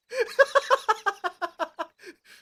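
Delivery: big high burst of laughter; loud laugh. Real, not acted. Streamer cracking up at their own joke, laughing. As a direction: crack up loudly, high and long.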